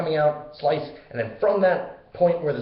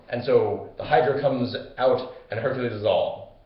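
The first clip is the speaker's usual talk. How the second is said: ordinary talk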